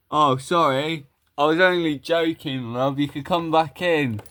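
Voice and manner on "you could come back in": usual speaking voice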